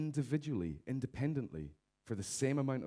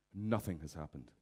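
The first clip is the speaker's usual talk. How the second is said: normal talking voice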